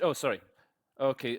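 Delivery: usual speaking voice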